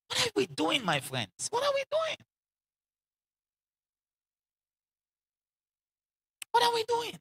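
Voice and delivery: high, rising plea to the crowd; pleading cry. Acted. Preacher pleading with the audience, cry out in a high voice.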